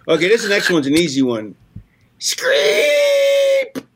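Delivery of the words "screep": shrill squeaky shriek sound; high shriek. Pretend. Performing fan's noise again.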